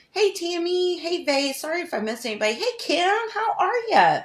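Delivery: light up as you greet each friend; sing-song hi to viewers; excited greeting. Real, not acted. Greeting live chat viewers by name.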